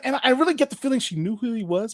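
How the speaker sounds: ordinary talk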